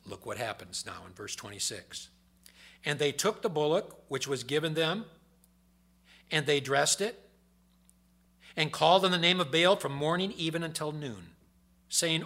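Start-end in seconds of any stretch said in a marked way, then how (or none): 2.9-12.2 preaching scripture. Acted. Reads scripture at full force.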